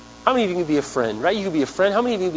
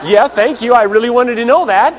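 sarcastic thanks; 8 semitones higher, 8 dB louder than usual. Retold or imitated. Sarcastic reply to overshare.

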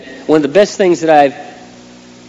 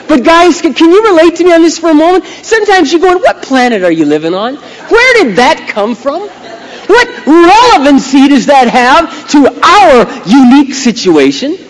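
high, loud, mock-baffled rant; exasperated husband; 11 semitones higher, 4 dB louder than usual. Husband asking wife what planet she lives on, exasperated. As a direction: plead high and loud in comic disbelief.

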